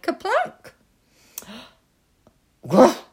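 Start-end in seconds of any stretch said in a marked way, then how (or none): 2.7-3.0 sharp gasp then a loud cry; startled gasp. Acted. Bear mother finds a strange child behind her, startled gasp and cry. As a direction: gasp as if choking on berries. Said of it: "she cried".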